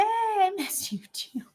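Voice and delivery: soft, slow whisper. Real, not acted. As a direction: whisper it tenderly. Tender reply to friend on call.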